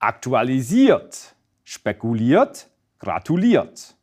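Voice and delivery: slow demo, stressing the final "iert" in Aktualisiert, spekuliert, gratuliert; careful word-by-word Aktualisiert, spekuliert, gratuliert, each with end stress. Shown as a demonstration. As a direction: draw out the last syllable of Aktualisiert, spekuliert, gratuliert. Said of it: "This is also where the stress remains".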